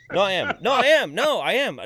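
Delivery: high, loud repeated insistence; mock insistence. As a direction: hammer it home in mock earnest.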